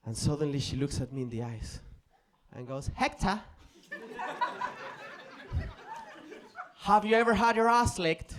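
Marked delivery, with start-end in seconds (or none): 3.0-8.3 raised, put-on British girlfriend voice; British girl's accent. British girl questions Hector while making out, in British accent. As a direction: imitate a matter-of-fact British girl. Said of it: "with a British girl".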